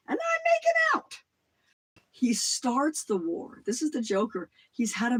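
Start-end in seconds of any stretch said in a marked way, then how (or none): 0.1-1.2 very high, loud gleeful crow; gleeful boast. Retold or imitated. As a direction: crow about the profit. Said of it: "When I was in college".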